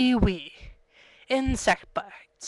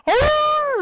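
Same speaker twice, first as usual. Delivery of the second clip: long, very high, loud howl; dog howl; 7 dB louder than usual. Retold or imitated. Greyhounds at the track after eating, howling. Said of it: "They go".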